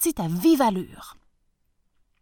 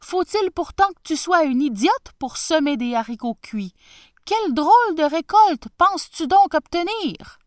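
high, loud scornful taunt; mocking; 8 semitones higher, 7 dB louder than usual. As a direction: jeer at her folly. Tsar mocking the girl.

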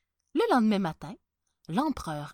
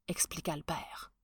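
ordinary delivery, nothing marked

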